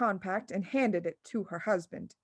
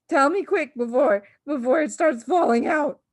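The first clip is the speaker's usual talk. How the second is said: louder urgent plea; pleading; 5 semitones higher, 7 dB louder than usual. A narrator's reading. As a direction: beg hurriedly. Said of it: "wailed the father".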